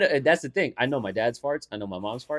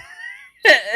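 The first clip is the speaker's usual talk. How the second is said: laugh; 9 dB louder than usual. Real, not acted. Bursting out laughing mid-story.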